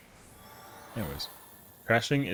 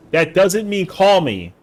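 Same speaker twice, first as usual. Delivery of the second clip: 1 exasperated outburst; 8 semitones higher, 8 dB louder, slower than usual. Real, not acted. Exasperated correction of Mama Axel.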